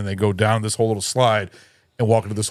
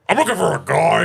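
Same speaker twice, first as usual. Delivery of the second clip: Edgar bug voice; 6 semitones higher than usual. Retold or imitated. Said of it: "rough, herky-jerky speaking".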